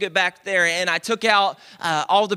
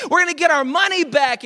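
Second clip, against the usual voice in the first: higher and louder, fired up; a husband puffing up his chest; indignant declaration; 9 semitones higher, 4 dB louder than usual. Retold or imitated. Husband telling his wife they will get a refund, worked up. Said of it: "That's my wife's name".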